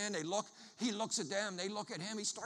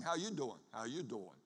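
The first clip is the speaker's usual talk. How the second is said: Philly greeting; 8 semitones lower, 6 dB quieter than usual. Shown as a demonstration. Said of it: "That's a good Philly question".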